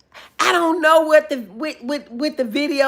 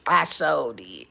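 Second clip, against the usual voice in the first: ordinary talk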